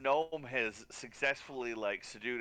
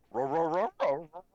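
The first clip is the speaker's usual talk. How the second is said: barking dog woofs, higher and louder; 6 semitones higher, 7 dB louder than usual. Acted. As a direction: woof at him like your own dog. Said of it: "I speak to him in my dog voice".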